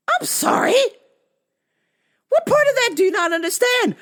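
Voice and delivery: high, loud, incredulous questioning; indignant challenge. Retold or imitated. Victim to doubting officer, indignant shouting.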